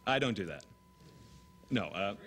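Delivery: ordinary talk, nothing marked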